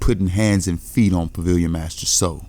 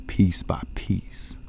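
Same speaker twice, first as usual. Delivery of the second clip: menacing whisper; 5 dB quieter, much slower than usual. Acted. Demon's threat trailing into whisper.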